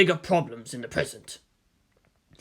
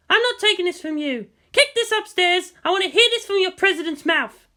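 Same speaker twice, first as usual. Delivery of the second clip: higher, sharp, combative officer; Misato's voice; 9 semitones higher than usual. A narrator's reading. Misato snapping at the general, higher and defiant. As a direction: push back hard, voice pitched up.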